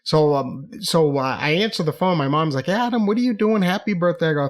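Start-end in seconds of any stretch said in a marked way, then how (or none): 2.7-4.3 high-pitched imitation of an excited mom; cheery mom greeting. Mom phoning her son on his birthday, cheery high greeting. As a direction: greet your son brightly, voice lifted high.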